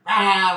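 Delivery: loud mock newborn cry; demonstrated baby bawling; imitated loud bawl. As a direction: belt out a short baby wail. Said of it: "supposed to bawl out loudly".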